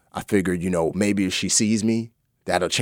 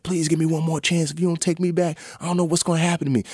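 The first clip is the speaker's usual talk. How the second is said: higher, rushed desperate plea; frantic pleading; 8 semitones higher, faster than usual. Retold or imitated. Begging his ex to take him back, high frantic plea. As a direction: beg her not to hang up. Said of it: "I called her frantically".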